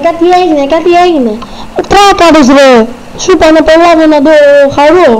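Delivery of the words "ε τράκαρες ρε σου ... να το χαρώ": angry scolding. Acted. The new car gets crashed.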